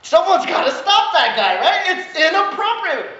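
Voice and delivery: mock shout about the chicken noises; mock alarm. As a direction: call for someone to stop him, hammed-up.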